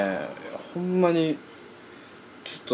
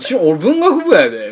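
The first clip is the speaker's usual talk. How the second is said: mock shrug; 7 semitones higher, 8 dB louder than usual. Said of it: "みたいなね".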